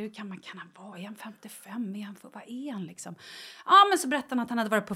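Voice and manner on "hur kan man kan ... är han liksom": conspiratorial whisper. Real, not acted. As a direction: whisper it so he can't hear. Viskar om mannens ålder. (viskar i smyg)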